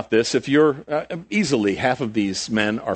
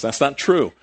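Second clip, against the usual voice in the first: normal talking voice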